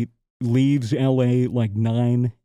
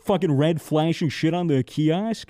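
ordinary talk, nothing marked

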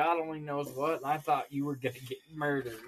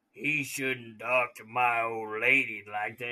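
slow, smoke-wrecked biker growl; drawled threat through a smoker's throat; heavy smoker voice; 3 semitones lower than usual. Retold or imitated. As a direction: imitate a menacing chain-smoking biker. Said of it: "I've had four hundred cigarettes today".

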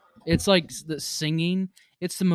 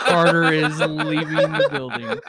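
announcer voice; 3 semitones higher, 9 dB louder, much slower than usual. Acted. Announcing exit, laughing.

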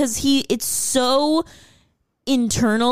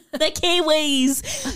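giddy Kiwi cheer; 6 semitones higher, much slower than usual. Real, not acted. Giddy shouting about the Kiwis.

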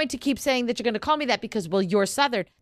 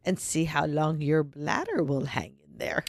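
mock menace; 6 semitones lower, 4 dB quieter, slower than usual. Teasing friend who needs bathroom.

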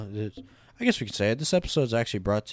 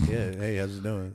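mock casual greeting; 4 dB quieter than usual. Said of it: "I'm like".

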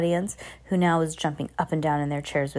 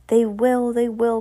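mildly raised crowd cry; excited cry; 5 semitones higher, 4 dB louder than usual. A narrator's reading. Witches' audience cheering on their leader, mildly excited cry. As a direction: agree eagerly, a touch excited. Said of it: "cried the audience".

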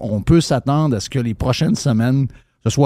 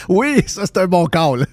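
higher-pitched, amused agreement through laughter; amused, laughing reply; 7 semitones higher than usual. Real, not acted. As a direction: agree while still laughing. Laughs at the guest's joke.

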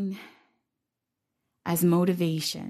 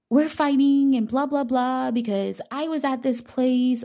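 pitched-up gossip voice; overheard woman; 7 semitones higher than usual. Retold or imitated. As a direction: imitate a worked-up young woman.

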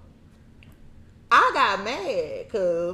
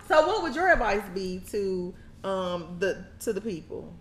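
ordinary talk, nothing marked

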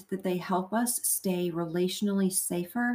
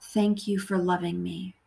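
guided meditation prayer. Acted. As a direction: pray phrase by phrase, leaving room to repeat.

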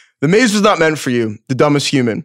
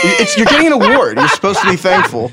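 mock indignant shout; 5 semitones higher than usual. Real, not acted. Mock scolding over the award.

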